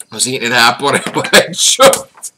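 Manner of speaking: loud, high, cracking up mid-sentence; helpless loud laugh through the words. Real, not acted. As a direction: burst out laughing as you tell it. Laughing about choking on apple.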